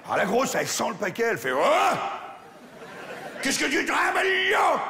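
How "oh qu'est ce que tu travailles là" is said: high shouted Champagne patois, as a big spider; Champagne-country accent. Acted. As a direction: holler it in a thick Champagne accent. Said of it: "je vous ai fait une araignée champenoise". (gros accent champenois, crié très aigu)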